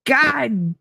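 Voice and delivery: high, strained squeal of mock fury, held back in volume; retold exasperated fury. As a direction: re-enact being mad in a small, squeezed high voice. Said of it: "I was so mad".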